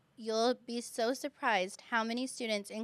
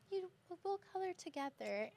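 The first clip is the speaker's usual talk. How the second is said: put-on soft, high kid voice; hushed, sweet classmate voice; kind child's invitation; 9 semitones higher, 9 dB quieter than usual. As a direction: imitate a child gently including a friend.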